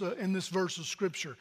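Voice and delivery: usual speaking voice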